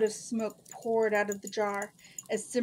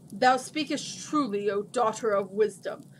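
loud, declamatory djinn voice; grand booming pronouncement; booming giant; 5 dB louder than usual. A narrator's reading. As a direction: proclaim it like a huge genie. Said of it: "in a booming voice that filled the cave".

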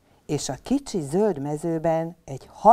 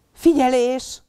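calling out; 7 semitones higher, 6 dB louder, slower than usual. Acted. Papa calls next game.

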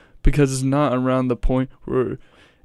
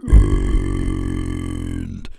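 slow, growling bass fry, dragged out; deep vocal fry imitation; much slower than usual. Reacting to a bass singer's line, slow deep fry imitation. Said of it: "he was like".

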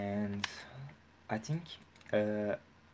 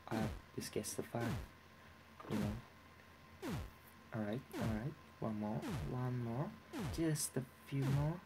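ordinary talk, nothing marked

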